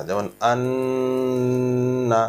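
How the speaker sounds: আন্না with the ন্ন hummed long through the nose; nasal hold demo. Shown as a demonstration. Teacher showing students the ghunnah nasal sound. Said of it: "নাকের মধ্যে যে আওয়াজটা এক আলিফ গুণ".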